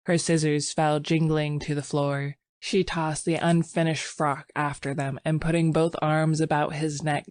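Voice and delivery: ordinary delivery, nothing marked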